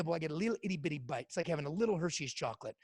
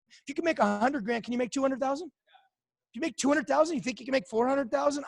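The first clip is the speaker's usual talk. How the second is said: retold hype building higher and louder; excited pitch to friend; 6 semitones higher, 6 dB louder than usual. Telling his friend Tony he'll make millions, excited rising voice. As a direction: sell him the dream, climbing with each number.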